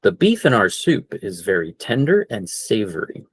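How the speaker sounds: natural full speed delivery; now say it at normal speed; same sentence at natural pace. Shown as a demonstration. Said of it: "Full speed".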